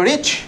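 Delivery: "রিচ" as one short syllable; "রিচ" in one go, unbroken; said in one breath. Shown as a demonstration. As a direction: say "রিচ" in a single beat. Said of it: "একদম উচ্চারণ করলাম".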